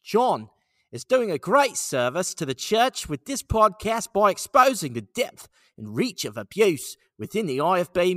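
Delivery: loud, high put-on Australian accent. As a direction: play it big as an Australian. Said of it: "Let's, let's do Australia".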